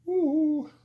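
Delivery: short, loud, high "woo"; high whoop. Real, not acted. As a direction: let out a high-pitched whoop. Exclaims while examining the beer.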